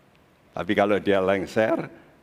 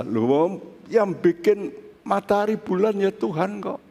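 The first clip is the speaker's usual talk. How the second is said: amazed preaching; 9 semitones higher than usual. Real, not acted. Preacher to congregation, amazed and much higher. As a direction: pitch climbs high with wonder.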